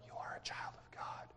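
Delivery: barely audible whisper. Acted. Preacher showing how the Spirit doesn't speak, whispered. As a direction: murmur it with no voice at all. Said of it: "is not there saying".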